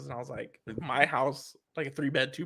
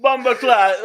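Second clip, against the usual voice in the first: put-on Jamaican accent; 10 semitones higher, 14 dB louder, slower than usual. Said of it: "with a Jamaican accent".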